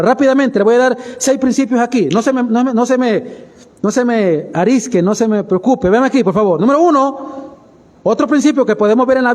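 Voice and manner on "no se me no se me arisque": usual speaking voice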